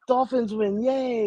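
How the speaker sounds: high-pitched little cheer; imitating little daughter. Dad mimicking his daughter after a Patriots win, gleeful. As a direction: lift it high into a child's yay. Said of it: "Or she'll go".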